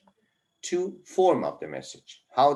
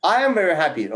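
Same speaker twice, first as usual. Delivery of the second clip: lively happy; 6 semitones higher, 7 dB louder, much faster than usual. Shown as a demonstration. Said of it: "It's monotone".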